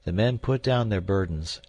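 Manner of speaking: plain reading voice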